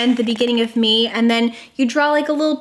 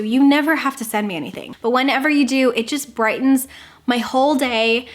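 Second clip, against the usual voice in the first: normal talking voice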